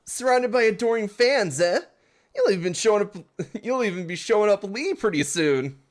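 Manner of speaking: high, loud mocking British accent. Rival teasing about adoring fans, loud British accent. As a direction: sneer it out in a bad British accent. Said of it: "I don't know British accents".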